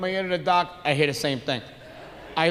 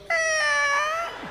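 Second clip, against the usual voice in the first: one very high, drawn-out squeak; imitated fan squeak; 3 dB quieter than usual. Storyteller imitating the fan winding down, slow high squeak. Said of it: "it goes".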